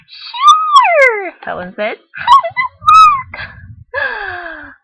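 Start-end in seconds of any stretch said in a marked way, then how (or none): 0.1-1.3 put-on squeaky high eager kid's yes; high girl voice. Helen's eager reply voiced. 2.2-4.8 put-on squeaky high eager kid's yes; high girl voice. Helen asks about the game.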